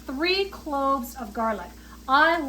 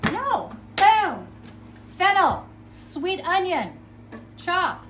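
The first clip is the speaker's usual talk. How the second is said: higher and louder, punchy; playful announcing; 5 semitones higher, 4 dB louder, slower than usual. Real, not acted. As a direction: announce each ingredient with flair.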